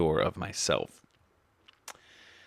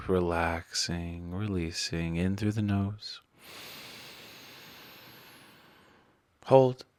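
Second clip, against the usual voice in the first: guided meditation; 2 semitones higher than usual. Acted. Leads breathing in guided meditation.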